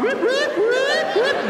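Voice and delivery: high, loud siren whoops; sharp squealed whoops; imitated police siren. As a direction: mimic a police siren with your voice.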